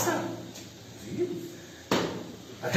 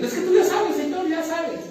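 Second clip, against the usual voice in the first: high, whiny dodge of a confession; high mock plea avoiding the sin; mock evasive confession; 8 semitones higher than usual. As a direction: play the sinner who won't say it, pitched up. Sinner evades confessing.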